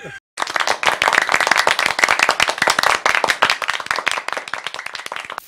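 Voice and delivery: long, high burst of real laughter. Real, not acted. Laughing hard with his digging mate over their finds. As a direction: cackle long and loud, high up. Big laugh after the find.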